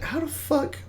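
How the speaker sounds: high squeaky stunned disbelief; high thin astonished squeak. Retold or imitated. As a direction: squeak it out in shock. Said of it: "I was like".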